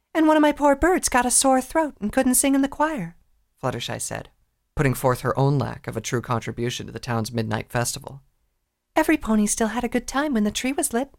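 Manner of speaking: meek little mare. Acted. Fluttershy voiced by reader.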